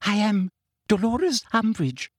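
high, frightened squeak of self-importance; high-pitched, terrified voice. A narrator's reading. Umbridge facing an armed centaur, terrified. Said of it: "in a high-pitched, terrified voice".

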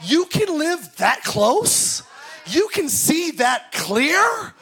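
a believer amazed at how close God can be; astonished wonder. Retold or imitated. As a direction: stretch each question out in disbelief. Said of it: "It's almost like".

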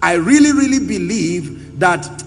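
loud, emphatic scripture proclamation; forceful preaching. Real, not acted. Preacher expounding Paul's prayer to the congregation, loud and emphatic. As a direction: preach it out with full projection, driving each phrase.

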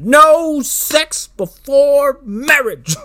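high, very loud, slow preacher's bellow; fire-and-brimstone movie preacher. Acted. Podcaster mocking a strict dad as a preacher, slow bellowed sermon. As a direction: thunder each word out like a hammed-up preacher. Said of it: "becomes the fucking preacher from the giant spider invasion".

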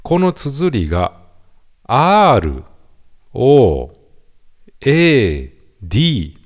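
ordinary delivery

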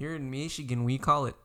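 hammed-up Michigan accent. Posing as a Michigan local explaining pop, Michigan accent. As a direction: impersonate a local with thick Michigan vowels. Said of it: "They talk like this in Michigan".

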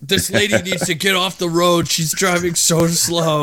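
high mock-complaining driver; put-on high voice, grumbling at traffic; Jake. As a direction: join in the hammed-up impression. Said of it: "his driving and".

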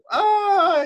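high laughing squeal; telling friends what they missed, squealing with laughter; excited shriek. Retold or imitated. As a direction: burst out with giddy excitement.

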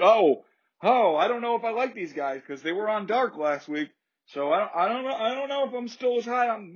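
high-pitched, dithering doubter; mock confused fan. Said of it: "now get confused".

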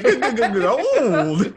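high, loud, drawn-out prospector cry of gold; old prospector. Retold or imitated. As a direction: call it out like a gold-rush miner. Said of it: "I just hear the old prospector voice".

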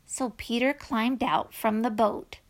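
usual speaking voice

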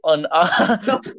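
giggling through the name; trying not to laugh. Real, not acted.

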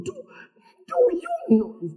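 high, slow, drawn-out mock voice; mimicking problem magnifiers. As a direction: stretch it out high, hamming it up. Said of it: "It's like they want you to know that".

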